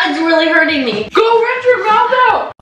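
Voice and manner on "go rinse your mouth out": loud, high urgent shout. Real, not acted. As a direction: yell it in a panic. Burning mouth, urging partner.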